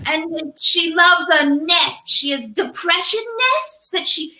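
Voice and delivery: put-on high, slow Australian wildlife-host accent; slow, marveling Australian narration of a bear; Steve Irwin impression. Podcast host voicing Steve Irwin introducing her as a bear, Australian accent. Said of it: "I don't know what accent I ended up with".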